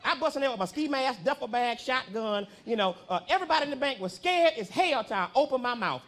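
ordinary talk

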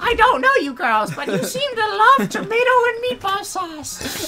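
falsetto cartoon villain, fast; high villain voice. Villain chatting up the girls, high falsetto. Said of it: "He's like".

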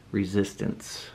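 normal talking voice

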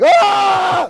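high, startled shriek. Retold or imitated. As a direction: shriek like someone caught off guard. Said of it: "For me, it's like".

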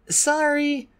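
put-on high, young dragon voice, apologetic; Clay apologizing. A narrator's reading. Clay to the Ice Wing, apologetic. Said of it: "Clay said".

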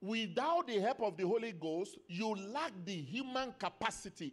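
loud, ringing scripture proclamation; forceful preaching. Real, not acted. Preacher reading Corinthians on the spirit from God, forceful preaching. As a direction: proclaim the verse in weighty phrases.

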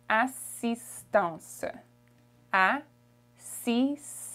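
assistance very slowly in French, asistance with a nasal end; French pronunciation. Shown as a demonstration. Teacher modelling assistance for travellers. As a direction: stretch assistance into French, syllable by syllable. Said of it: "assistance in French is".

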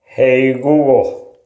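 normal talking voice

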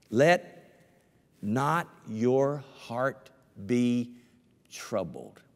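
each word drawn out slowly; solemn slow scripture read; slow scripture emphasis. Shown as a demonstration. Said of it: "two times in the same chapter".

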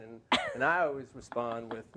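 usual speaking voice

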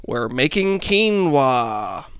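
very slow, drawn-out impression; David Lynch. Recalling how the director says it, very slow imitation. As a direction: imitate a very slow, deliberate delivery. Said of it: "listened to David Lynch say".

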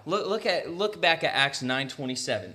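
normal talking voice

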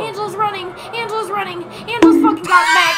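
high, loud play-by-play; excited commentary. Real, not acted. Friend narrating the chase, excited high play-by-play. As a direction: hype up the chase.

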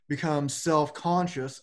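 normal talking voice

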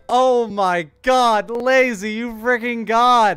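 high, loud, drawn-out cheer; loud, high, slow triumphant yell; ecstatic shout. Real, not acted. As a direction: yell it like you just won big. Huge in-game win.

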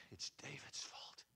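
hushed, slow whisper of blame; a conspiratorial whisper among angry men. Retold or imitated. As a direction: breathe it out as a grumbling whisper.

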